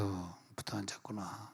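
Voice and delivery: hushed teasing aside; soft aside. Retold or imitated. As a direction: mutter it under your breath. Pastor mock-murmurs watching congregation.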